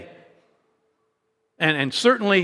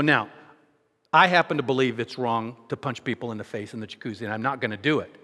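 lower, softer reassurance; calm reassurance; 7 semitones lower, 5 dB quieter than usual. Retold or imitated. Preacher reassuring the stranger after the mock threat, calm. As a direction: settle it down gently.

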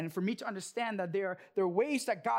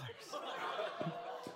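quiet laugh; 7 semitones lower, 12 dB quieter than usual. Real, not acted. Laughing at losing more money.

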